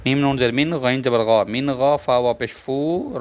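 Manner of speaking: ordinary delivery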